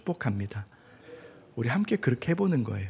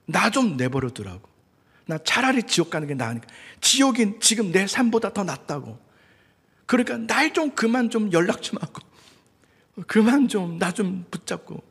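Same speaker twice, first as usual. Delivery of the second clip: mock angry outburst; 7 semitones higher than usual. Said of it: "막 화를 내더라고요".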